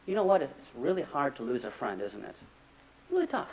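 put-on soft, tender comfort to a child; a parent quietly consoling a child who lost a friend; gentle parent. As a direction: ease the child into it softly. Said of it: "sit down with your child and say".

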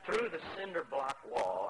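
usual speaking voice

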